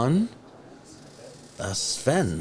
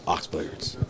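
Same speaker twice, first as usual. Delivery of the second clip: low, unvoiced cool announcer voice; 4 semitones lower, 3 dB quieter than usual. Shown as a demonstration. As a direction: say it like a cool sponsor tag. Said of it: "That's what I'm gonna say every time".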